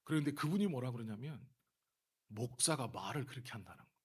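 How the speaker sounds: ordinary talk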